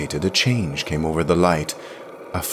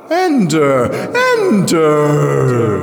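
high, loud, ringing calls, falling away; mocking urging; 9 semitones higher, 8 dB louder, much slower than usual. A narrator's reading. Mirrored lips urge Jirel to enter, mocking. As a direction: lure her in with echoing calls. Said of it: "urged that mocking voice".

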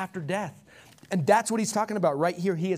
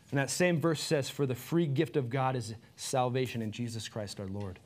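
closing prayer; 3 semitones lower than usual. Acted. Pastor leads closing prayer.

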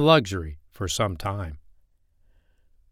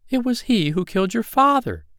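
high, light fairy voice; a gentle fairy speaking; 12 semitones higher, 3 dB louder than usual. Acted.

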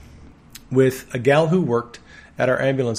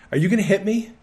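mildly anxious question, pitched up and rising; fearful; 7 semitones higher, faster than usual. Retold or imitated. Patient asks the man praying over her knee, mildly fearful. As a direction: ask it higher, imitating a worried patient. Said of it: "why she was so fearful".

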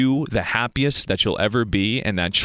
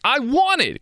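hammed-up craving, pitched way up; mock-greedy grab for the product; jumping at the ad's offer; eager want; 13 semitones higher than usual. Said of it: "And you're like".

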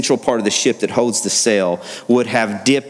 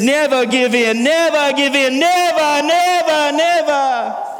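high, ringing cry of never; soaring higher on each never; Churchill; slower than usual. Retold or imitated. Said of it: "Winston Churchill says".

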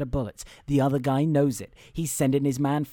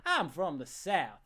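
trying out a Southern accent; 3 semitones higher, 7 dB quieter, slower than usual. Shown as a demonstration. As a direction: test a Southern drawl on these words. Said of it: "where's my Southern accent?".

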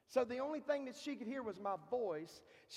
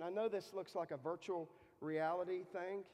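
normal talking voice

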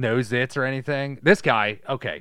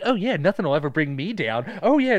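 cheery upbeat guy; 5 semitones higher, 6 dB louder than usual. Acted. Voicing the happy mannequin guy.

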